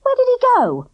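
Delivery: high, loud anxious question; urgent question. A narrator's reading. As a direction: scan the street urgently. Girl searching anxiously.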